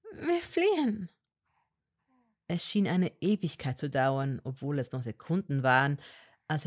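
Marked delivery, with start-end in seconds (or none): 0.1-1.1 put-on higher young woman's voice, pleading and firm; Hermine voice. A narrator's reading. Hermine to Malfoy, higher and insistent. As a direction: argue with him, voice raised high.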